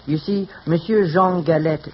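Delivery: put-on slow French-accented Monsieur Jean Galette; phony French accent. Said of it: "without the phony French accent".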